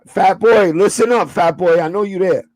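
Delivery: ordinary delivery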